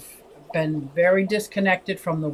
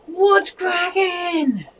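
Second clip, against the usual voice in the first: mock scream; 10 semitones higher, much slower than usual. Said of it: "I think you're screaming".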